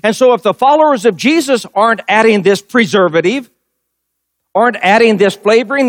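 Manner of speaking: normal talking voice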